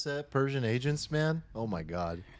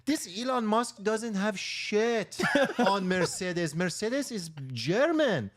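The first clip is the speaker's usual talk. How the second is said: Persian car salesman; 6 semitones higher than usual. Pretend. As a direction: sell the car hard with a thick Persian accent. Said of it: "impersonate a Persian car salesman at a Mercedes dealership".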